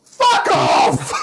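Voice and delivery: loud shout of disbelief. Real, not acted. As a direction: yell it out in shock. Reacting to the draw.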